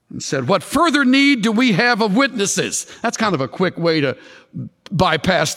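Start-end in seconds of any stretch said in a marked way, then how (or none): 0.5-2.9 high-pitched mock indignation of a judge; acted outrage. High priest tearing his clothes before the council, acted outrage. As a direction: declaim it as an outraged accuser.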